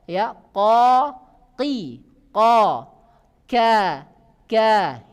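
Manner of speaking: ko, ki, ka said thin, loud, lips not pushed forward; thin kaf drill. Shown as a demonstration. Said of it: "Ini kaf tipis".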